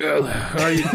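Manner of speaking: squawky laughing duck impression. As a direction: quack it out mid-laugh. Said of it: "You do a duck voice".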